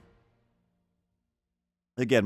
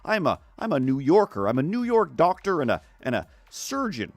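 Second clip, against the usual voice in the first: hammed-up grating American accent, pitched higher; Doctor Stephen Strange; 5 semitones higher than usual. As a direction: put on a laboured American accent, like just learning to talk. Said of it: "with his American voice ... grates on me".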